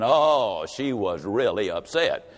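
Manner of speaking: low drop on "she was really upset"; low refrain. A narrator's reading. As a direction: let it sink low. Repeated refrain.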